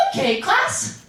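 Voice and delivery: a hint of a sing-song Spanish teacher. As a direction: announce like a chirpy teacher. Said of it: "teacher goes".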